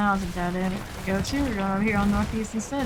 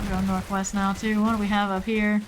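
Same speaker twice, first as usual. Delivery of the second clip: ordinary delivery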